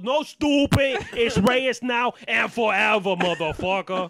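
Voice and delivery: loud, drawn-out mock defiance; acted defiant wife. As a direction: holler it slowly, putting the husband in place. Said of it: "She goes".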